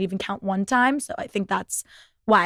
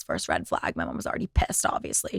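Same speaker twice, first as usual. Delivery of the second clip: hushed, breathy whisper of a side remark; whispered aside; 8 dB quieter than usual. Real, not acted. Telling listeners mom was already pissed, in a whisper. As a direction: drop to a secret whisper.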